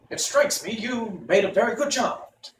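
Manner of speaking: high, hollow-headed wooden man; Pumpkinhead voice. Acted. Jack Pumpkinhead asks Tip where they go, high voice. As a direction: pitch it way up like a carved pumpkin man.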